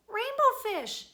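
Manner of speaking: high, loud call of a name; little blue fish calling after Rainbow Fish. Acted. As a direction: call after him to wait. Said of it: "he called".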